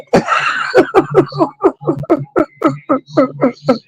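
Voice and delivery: long high-pitched laughing fit; helpless laughter. Real, not acted. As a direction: crack up at your own story. Cracks up at own story.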